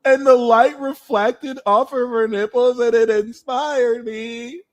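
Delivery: high, teary, emotional gush; mock weepy oversharing, high-pitched; crying water sign. Water sign oversharing feelings, high and teary. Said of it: "So water signs are".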